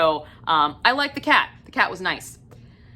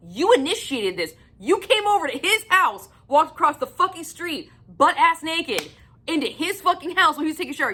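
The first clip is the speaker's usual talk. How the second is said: much higher, incredulous scolding; exasperated rant; 6 semitones higher than usual. Real, not acted. Reviewer scolding a character for a baffling scene, higher and incredulous. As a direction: tear into her with mounting disbelief.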